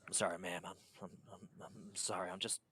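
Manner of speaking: soft, halting and subdued; trailing, stammered exhaustion; shaken, weary apology. Acted. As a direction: apologise quietly, worn out.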